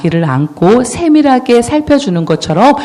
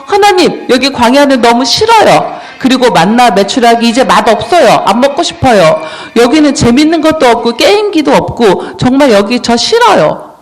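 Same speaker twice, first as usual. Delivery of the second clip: high-pitched, whiny protest; hammed-up petulant griping; acted complaining; 5 semitones higher, 4 dB louder than usual. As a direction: grumble to God like a fed-up kid. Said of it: "불평불만을 하면서".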